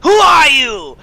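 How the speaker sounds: high, loud startled shout; startled cry. Acted. Gert surprised by an attacker, high loud cry. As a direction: cry out as you're ambushed. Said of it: "you hear Gert go".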